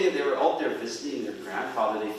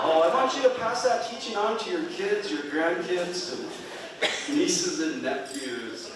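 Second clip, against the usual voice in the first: laughing; 6 semitones higher, slower than usual. Retold or imitated. Elders pass teaching, laughing.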